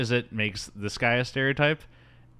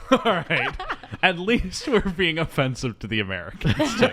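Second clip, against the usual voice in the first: laughing; 8 semitones higher than usual. Retold or imitated. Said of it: "And I'm like".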